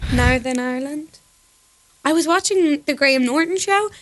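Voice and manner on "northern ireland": a tentative Northern Irish attempt; Northern Irish accent. Shown as a demonstration. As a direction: show a Northern Irish accent with rising end. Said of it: "I know what Northern Ireland is like".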